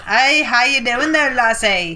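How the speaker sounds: slow, put-on Scottish accent. Shown as a demonstration. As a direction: greet in a playful Scottish accent. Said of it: "like, a Scottish accent".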